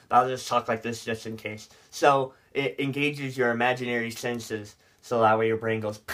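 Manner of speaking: lightly voiced nerd. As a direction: play a mild know-it-all nerd. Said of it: "so I can do my nerd voice".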